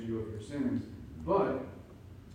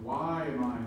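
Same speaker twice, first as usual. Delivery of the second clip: ordinary delivery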